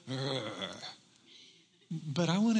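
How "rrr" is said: low, soft imitated lion growl; guttural growl. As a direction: growl deep in the throat like a lion. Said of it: "this guttural".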